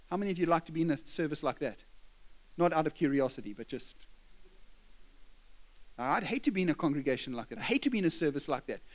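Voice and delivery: ordinary delivery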